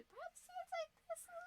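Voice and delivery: squeaky high elf voice; tiny high voice. Pretend.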